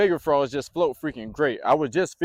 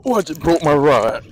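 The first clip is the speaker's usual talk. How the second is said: real shocked shout; 2 semitones higher, 8 dB louder than usual. Real, not acted. Rod snaps on a big fish.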